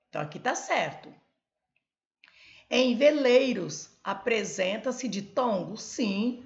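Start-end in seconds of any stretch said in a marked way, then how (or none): 2.7-4.0 veleiros slow, loud and high; emphatic reading. Shown as a demonstration. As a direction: stress veleiros as the quiz word. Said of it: "reading quiz aloud".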